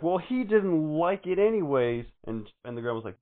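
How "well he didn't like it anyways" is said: high, loud, slow dismissive quote. Retold or imitated. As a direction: drawl it out dismissively. Retold daughter's dismissive reply.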